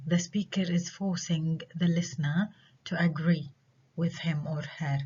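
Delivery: normal talking voice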